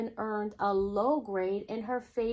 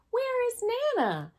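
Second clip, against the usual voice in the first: put-on high, eager child's question; Bree asking; 13 semitones higher than usual. A narrator's reading. Bree asking her parents where Nana is, high child voice. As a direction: ask it brightly in a child's high voice. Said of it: "and she asked".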